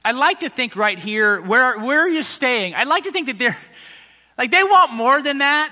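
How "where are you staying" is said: ordinary delivery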